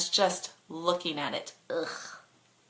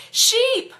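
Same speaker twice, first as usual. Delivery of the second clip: high loud alarmed yell; 8 dB louder than usual. Acted. The passenger yells as a sheep appears, high and loud. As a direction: shout the warning in alarm. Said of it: "I yell".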